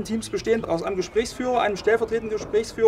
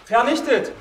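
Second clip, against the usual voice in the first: called out hard, falling at the end; 3 dB louder, slower than usual. Shown as a demonstration. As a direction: call it out to the room. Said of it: "Und dann rufe ich".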